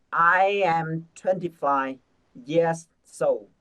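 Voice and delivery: slow, evenly spaced words; slow model sentence. Shown as a demonstration. Teacher reading the sentence slowly as a model. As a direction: read it slowly for the class. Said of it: "chúng ta đọc hết cả câu nhá".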